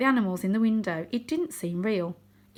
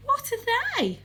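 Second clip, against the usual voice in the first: put-on much higher, wondering young cat; young tom Varjak; slower than usual. A narrator's reading. Young cat asks his friend, high and curious. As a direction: ask it wide-eyed and high.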